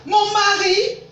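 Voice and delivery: high, loud incredulous question; loud, rising outburst of disbelief; outraged disbelief. Acted. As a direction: throw it back as a shocked, rising question. Suspects sister wants her husband.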